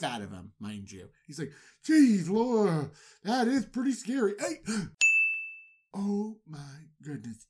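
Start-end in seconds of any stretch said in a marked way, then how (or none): 1.8-4.6 louder, higher startled reaction; a hint of a spooked servant; mock alarm. Cupbearer reacts to dream. 4.6-5.6 breathy gasp of sudden realization; sharp gasp. Retold or imitated. Cupbearer suddenly remembers.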